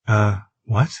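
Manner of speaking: mildly startled question; a touch of sharp disbelief; sudden, sharp. A narrator's reading. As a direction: cut in with a pointed "what?". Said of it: "suddenly and very sharply".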